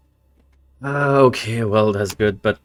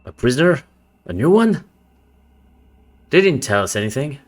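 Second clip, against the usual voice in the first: louder, higher, startled guard; surprised guard; 5 semitones higher, 4 dB louder, faster than usual. Acted. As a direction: react with sudden alarm.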